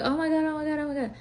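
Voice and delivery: high, rising panic repeating it; panicked. Retold or imitated. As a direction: blurt it like you can't breathe. Panicking, thinks she is dying.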